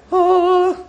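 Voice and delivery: high held "oh"; one long, level, high note as if all were effortless; angelic "oh". Acted. Preacher mocking the idea that it's easy, airy high "oh".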